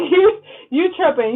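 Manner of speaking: laughing, high and loud; giggling through the scold. Real, not acted. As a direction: crack up while scolding. Mock scolding the viewer, laughing.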